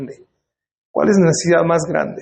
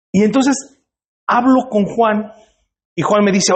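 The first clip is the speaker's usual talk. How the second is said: ordinary delivery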